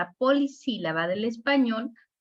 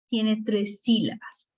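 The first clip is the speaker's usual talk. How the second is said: ordinary delivery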